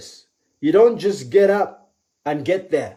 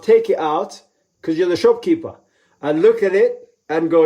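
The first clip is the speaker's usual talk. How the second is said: ordinary delivery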